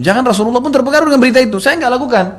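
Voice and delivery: high, loud, rapid plea; urgent protest. Retold or imitated. Aisyah begging her father to answer, urgent protest. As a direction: plead your innocence high and fast.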